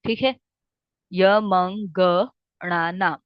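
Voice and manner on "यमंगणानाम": slow, deliberate chant of यमंगणानाम; very slow recitation. Shown as a demonstration. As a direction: draw out यमंगणानाम so students can copy it. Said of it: "यमंगणानाम (repeated)".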